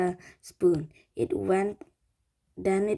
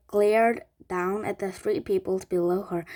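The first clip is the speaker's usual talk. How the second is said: usual speaking voice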